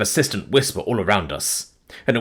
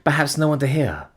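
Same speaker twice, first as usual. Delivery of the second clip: higher, aching lament; tearful mourning; 6 semitones higher than usual. Acted. Fiancée asking about his last hours, tearful. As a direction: ache for his loneliness.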